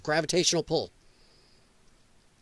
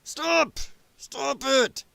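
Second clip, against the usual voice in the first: higher, slow mocking plea to stop; mock Democrat; 9 semitones higher, much slower than usual. Streamer mocking Democrats hushing party members, high whine. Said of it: "party people go".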